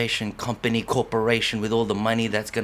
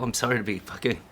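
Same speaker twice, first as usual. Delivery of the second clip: usual speaking voice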